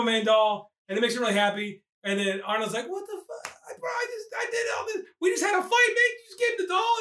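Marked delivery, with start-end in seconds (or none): 2.9-6.9 very high, squeaky mock disbelief; dismayed father. Father protesting the doll was given away, high-pitched dismay. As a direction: complain in a hammed-up high voice.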